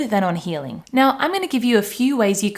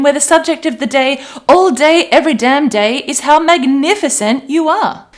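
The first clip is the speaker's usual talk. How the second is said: higher, much louder cheery announcer build; upbeat radio host; 5 semitones higher, 7 dB louder than usual. Pretend. Coach hyping the self-love station, higher and louder. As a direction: sell it like the best station on air.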